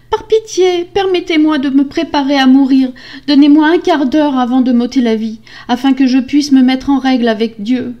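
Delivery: high, loud, urgent plea; desperate begging. A narrator's reading. Wife begging husband to spare her, desperate plea. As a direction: beg for your life. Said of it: "en le suppliant de l'épargner".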